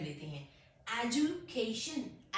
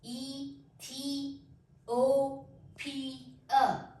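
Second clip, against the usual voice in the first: ईथियोपिया said very slowly, syllable by syllable; broken into syllables; 2 semitones higher, much slower than usual. Shown as a demonstration. Teacher shows students the syllables of ईथियोपिया, very slowly. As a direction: stretch out ईथियोपिया, one syllable at a time. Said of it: "इसके नीचे lines लगाई हैं".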